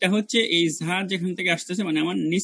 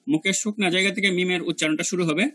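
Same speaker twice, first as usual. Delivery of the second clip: ordinary talk, nothing marked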